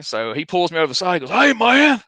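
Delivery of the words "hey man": high, loud raspy-voiced warning; Tommy Rich scolding. Veteran wrestler warns the young guy he nearly went to jail, high and loud. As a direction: bark it like a gruff old wrestler.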